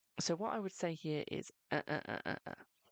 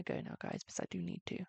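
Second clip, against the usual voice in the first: whisper; 2 semitones higher than usual. Real, not acted. Whispering while signing off.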